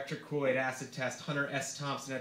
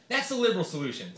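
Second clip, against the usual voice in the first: higher, louder, dismissive politician; Santorum voice; 6 semitones higher, 4 dB louder than usual. Acted. Senator dismissing the reporter's question, higher and louder. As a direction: brush off the liberal answer. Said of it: "said Santorum".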